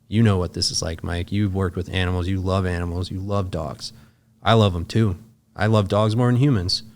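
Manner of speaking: normal talking voice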